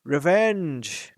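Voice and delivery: loud scornful snort of a word; dragon snorting. Acted. Smaug scoffs at the idea of revenge, snorting. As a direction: spit the word out in contempt. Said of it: "he snorted".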